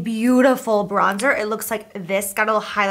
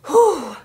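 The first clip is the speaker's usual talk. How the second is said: loud exhale; 9 semitones higher, 7 dB louder than usual. Real, not acted. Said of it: "I'm out of breath".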